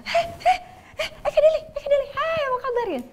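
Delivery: very high, sing-song fake-friendly hello; imitating a churchgoer's shrill sugary welcome at the church door; mock gushing greeting. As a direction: call out like an over-eager acquaintance putting on a show.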